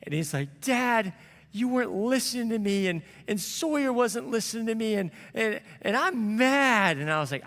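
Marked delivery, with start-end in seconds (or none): 0.6-7.0 put-on high, whiny kid voice, louder; upset little boy. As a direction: play the hurt six-year-old son. Son voiced in retold story.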